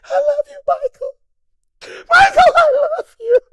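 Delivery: very high, loud, hammed-up crying confession; mock sobbing fan. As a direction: wail it into his ear like a weeping groupie. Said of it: "crying like a little groupie bitch".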